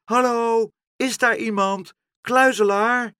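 high, loud searching call; shouted hello, pitched high to carry. Acted. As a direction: call up to someone hidden in a tree. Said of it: "stond te roepen".

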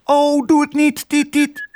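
very high, piping bird plea; pleading thrush. A narrator's reading. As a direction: chirp it out imploringly, ending in bird calls. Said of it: "vroeg de lijster Tiet". (hoog, smekend vogelstemmetje)

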